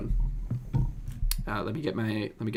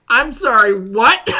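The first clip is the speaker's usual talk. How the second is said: high, loud incredulous what; can't believe what he just heard; shocked disbelief; 7 semitones higher, 10 dB louder, slower than usual. Real, not acted. Hearing about whispering into mouths, shocked. Reacting again, louder and higher.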